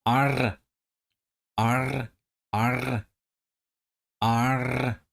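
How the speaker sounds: R drawn out into a roll; trilled R. Shown as a demonstration. Back to drilling pirate sounds, trilled R. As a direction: roll each R at the end. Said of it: "Back to the R".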